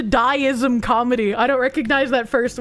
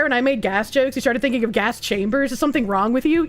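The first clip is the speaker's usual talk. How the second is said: ordinary talk, nothing marked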